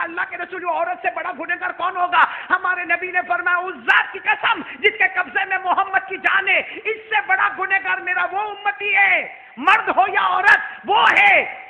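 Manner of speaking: high, loud preacher's shout; fiery preaching. Acted. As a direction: thunder it from the pulpit. Preaching at full force.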